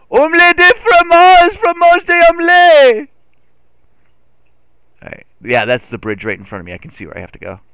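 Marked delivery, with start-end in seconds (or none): 0.1-3.1 slow, very high, loud fake French; mock French shout. Streamer plays with French food words in a high mock shout. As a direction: screech it in a hammed-up French accent.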